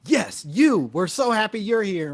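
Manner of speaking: high, loud mock enthusiasm; overeager welcome. Locals greeting strangers at a hurling game, exaggerated excitement. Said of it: "a weird vibe of them being like".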